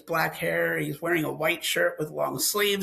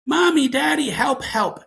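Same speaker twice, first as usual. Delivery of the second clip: higher, louder cry for help, tapering off; mock shout of a small boy; retold child's shout; 7 semitones higher, 6 dB louder than usual. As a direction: imitate a frightened kid calling his parents. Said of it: "Henry starts shouting".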